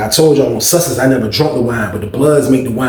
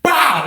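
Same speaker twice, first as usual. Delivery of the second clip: sharp high whop; imitated punch sound; 12 semitones higher than usual. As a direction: smack the sound out.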